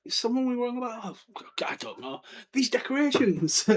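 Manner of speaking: a hint of hammed-up despair; mock Hermione dismay. Hermione spotting Halloween decorations, mock despair. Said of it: "And I was like".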